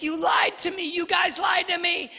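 put-on high wailing kid; crying child. Crying son accusing his parents, high wail. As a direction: wail it like a hurt little boy. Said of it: "He's like".

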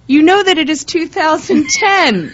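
high laughing confession. Real, not acted. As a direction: laugh while you admit it. Laughing at late taxes.

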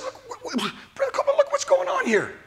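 high, breathless urgent call; alarmed. Retold or imitated. Preacher retelling election night to Brother Copeland, alarmed high call. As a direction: grab his friend's attention in alarm. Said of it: "I said".

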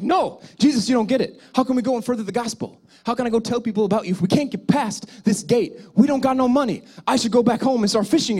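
hammed-up panic over no money; freaking out. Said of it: "Peter was freaking out".

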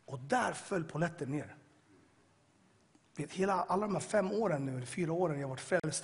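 ordinary talk

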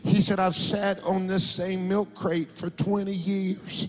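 retelling the milk crate conversion with rising passion; rising preaching. Acted. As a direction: preach it with growing fervour. Preacher builds the sermon story.

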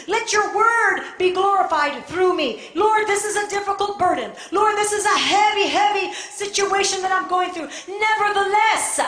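high, loud, burdened plea; fervent prayer. Acted. Preacher praying to the Lord under a difficult burden, fervent prayer. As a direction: pray at full preaching force, pushing through.

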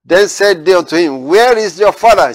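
preaching at full force. Acted. Preacher proclaiming Jesus' answer to the Pharisees, forceful. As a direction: lift the words of Jesus over the church.

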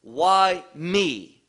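"me" punched loud and held; stress on me. Shown as a demonstration. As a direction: hit the word "me" hard. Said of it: "the emphasis here is on that word me".

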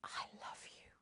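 whispered into Daddy's neck; tender child's whisper. Retold or imitated. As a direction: breathe it softly like a child to her father. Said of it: "just say".

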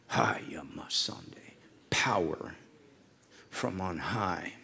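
hushed prophetic whisper, slow; whispered tongues. Real, not acted. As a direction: breathe the words slowly in awe.

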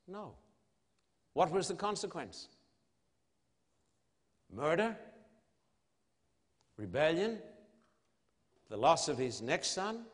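ordinary talk

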